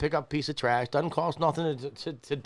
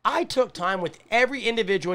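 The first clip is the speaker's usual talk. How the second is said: usual speaking voice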